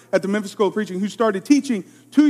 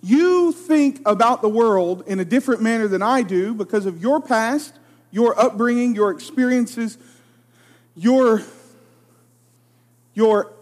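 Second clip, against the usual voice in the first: normal talking voice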